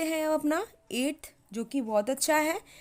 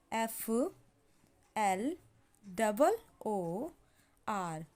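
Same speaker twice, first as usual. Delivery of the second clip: slow soft dictation spelling; 4 semitones lower, 6 dB quieter, much slower than usual. Shown as a demonstration.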